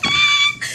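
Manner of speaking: high, delighted shriek; joyful scream. Retold or imitated. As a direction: squeal with joy at the news. Reacting to not needing meds.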